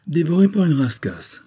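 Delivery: high mocking repeat of the phrase. A narrator's reading. Old sailor teases the detective, mocking. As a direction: toss it back high, amused. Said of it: "répétait le loup de mer".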